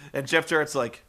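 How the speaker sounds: normal talking voice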